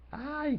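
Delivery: chillido agudo y sostenido, sin fuerza; grito imitado. Acted. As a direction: imita a la liebre que huye gritando, muy agudo. (grito agudo fingido)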